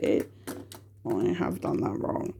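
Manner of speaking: whispered aside, under her breath; a private whispered admission of a slip; whispered to herself. Real, not acted. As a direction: drop to a whisper as you spot the mistake. Realizes her sheet is wrong.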